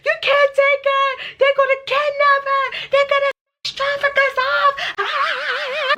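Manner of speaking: high, wailing, hammed-up sobbing plea; mock crying plea. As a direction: overdramatic fake sob begging them to stop. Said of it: "like, crying".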